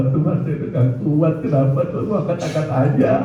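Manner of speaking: high-pitched pleading with God; retold desperate complaint. Preacher recalls questioning God in pain, high desperate complaint. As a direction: protest in a high, helpless voice. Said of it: "kalau saya sampai ngomong begitu".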